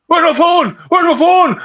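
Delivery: high frantic panicked shout; frantic searching for the lost phone; panicked cry. Retold or imitated. Retelling his backstage panic, high frantic cry. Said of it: "I went into a huge panic".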